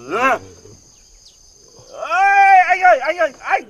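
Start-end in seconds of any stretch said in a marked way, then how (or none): 2.1-3.6 loud high call for help. Acted. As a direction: yell for the boss's attention, loud and high. Stuck referee calls out for help. (gọi to, giọng cao cầu cứu)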